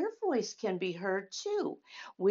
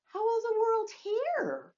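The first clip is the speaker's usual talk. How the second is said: put-on much higher, small childlike voice; high little-girl question. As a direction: ask it as the curious little girl Mari. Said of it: "Mari asked".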